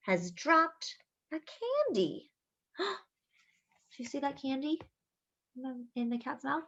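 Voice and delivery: soft gasp then hushed wonder; mock surprise. Showing children the candy, hushed surprise. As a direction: gasp as if you spotted a secret.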